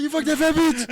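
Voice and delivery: high, loud hooting taunt; mock jeering shout. Storyteller imitating friends teasing him, high jeering shout. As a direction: holler it like a ribbing buddy.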